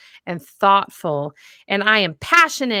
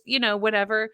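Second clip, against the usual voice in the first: ordinary talk, nothing marked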